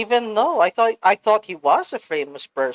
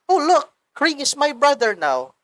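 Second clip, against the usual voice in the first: higher-pitched, lightly mocking quirky girl; 7 semitones higher than usual. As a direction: lightly put on a quirky kid's excitement. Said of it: "She's just trying to be quirky".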